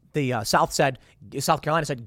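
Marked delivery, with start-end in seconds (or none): none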